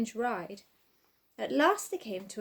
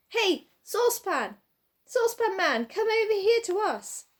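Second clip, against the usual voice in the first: calling out; 9 semitones higher, 5 dB louder than usual. A narrator's reading. Calling a friend across a field.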